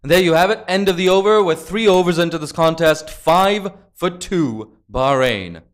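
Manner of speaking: loud, lifted end-of-over announcement; play-by-play score call. Real, not acted. Commentator gives viewers the score at end of over, play-by-play score call. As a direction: announce the score loudly to the audience.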